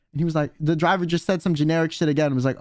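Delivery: ordinary talk, nothing marked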